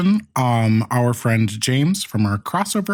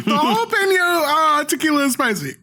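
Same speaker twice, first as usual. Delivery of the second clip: much higher, laughing from the heat; high-pitched; 12 semitones higher than usual. Real, not acted. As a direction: squeak it out, burning mouth. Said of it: "Your pitch has raised, like, three octaves".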